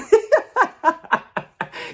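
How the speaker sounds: loud, high cackle; bursting into laughter at her own joke. Real, not acted. Vlogger laughing at her own joke, high cackle.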